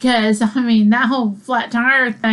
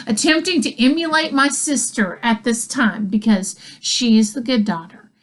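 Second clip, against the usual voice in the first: usual speaking voice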